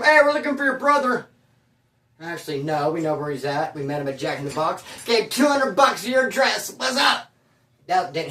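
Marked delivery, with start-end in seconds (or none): 0.0-1.3 high, raised mock-officer voice; imitated calling out. Parole officers hunting for his brother, calling out. 5.0-7.3 higher, drawn-out mock shout; mock taunting shout. Officers pretending they paid an informant, taunting shout.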